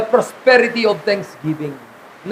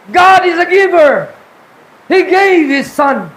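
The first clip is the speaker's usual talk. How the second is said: proclaiming; 6 semitones higher, 5 dB louder than usual. Acted. Preacher proclaiming key truth.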